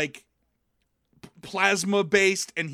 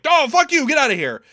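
high, loud mock outburst; hammed-up angry dismissal; angry retort; 9 semitones higher, 9 dB louder than usual. Crusher telling Picard off over her boyfriend, mock furious.

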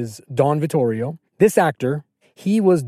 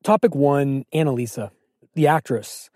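ordinary talk, nothing marked

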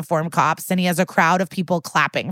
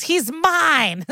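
high-pitched mock yell, no real volume; high, playful imitation of yelling; mock jealous yell; 9 semitones higher than usual. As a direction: act out a possessive woman's yell, pitched way up. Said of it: "another woman runs up and yells".

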